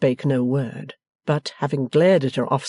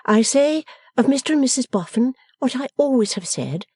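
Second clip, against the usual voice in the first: higher-pitched, firm reply; steadfast Bella; 10 semitones higher than usual. A narrator's reading. Bella answering Lavvy and Ma, steadfast. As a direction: stand by it, pitched up.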